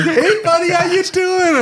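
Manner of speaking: very high, mock-doting hello; gushing buddy greeting. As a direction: greet them in a silly sing-song squeal. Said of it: "and I'm like".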